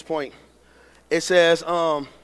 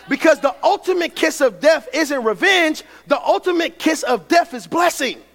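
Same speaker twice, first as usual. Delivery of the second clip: higher preacher's cadence building to a shout; fervent preaching; 7 semitones higher, 3 dB louder than usual. Acted. Preacher voices Joseph forgiving his brothers, swelling fervor. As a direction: preach it, swelling to the last line.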